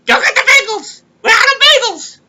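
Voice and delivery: very high, loud nagging wife voice; wife yelling at her husband to fetch bagels; shrill nagging wife. Retold or imitated. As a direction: screech the order like a nagging wife. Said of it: "my wife, she tells me".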